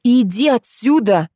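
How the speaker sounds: drawn-out, irritated order; angry. A narrator's reading. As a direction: chase him off crossly. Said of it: "обозлилась я". (сердито прогоняя)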